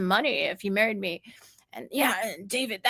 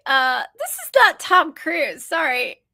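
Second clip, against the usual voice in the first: loud, slow, higher-pitched contradiction; retold disbelief; 7 semitones higher, 7 dB louder than usual. Woman correcting the kids, loud slow disbelief. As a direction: break it to them, drawn out and emphatic.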